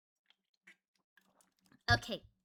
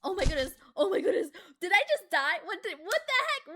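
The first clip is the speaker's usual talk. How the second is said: startled shock; 5 semitones higher, 8 dB louder, faster than usual. Real, not acted. Character suddenly falls.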